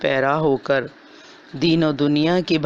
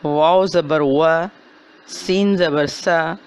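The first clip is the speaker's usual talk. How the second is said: recitation drill. Shown as a demonstration. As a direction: recite each letter clearly, then draw out the whole word. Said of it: "ساتھ ساتھ پڑھیں".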